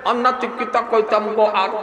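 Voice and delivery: put-on a light old man's voice, words mushy and without teeth; toothless grandfather. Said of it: "আমার দাদা যারা". (দাঁতহীন বুড়ো দাদার হালকা নকল)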